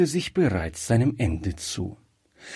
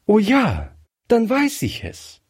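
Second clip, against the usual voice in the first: very high, sudden exclamation; bright recognition; 11 semitones higher, 3 dB louder than usual. A narrator's reading. Colonel's daughter realises who is calling, eager recognition. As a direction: exclaim it as it dawns on you.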